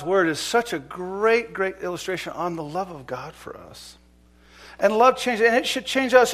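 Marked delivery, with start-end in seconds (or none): none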